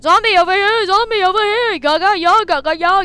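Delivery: loud, high hollering; calling out. Real, not acted. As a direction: yell to get Zombie's attention. Calling Zombie over.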